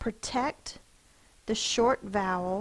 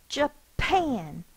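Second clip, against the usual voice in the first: "Japan" with a forceful PAN, higher; stress on PAN; 6 semitones higher, 3 dB louder than usual. Shown as a demonstration. Teacher showing the class the stressed syllable. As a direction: punch PAN in "Japan" like scolding a dog. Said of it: "Because it's more forceful".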